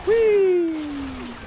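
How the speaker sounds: a long, high squeal of delight; high whee. Acted. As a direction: squeal like on a ride. Gleeful game squeal.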